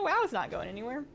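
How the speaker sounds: ordinary talk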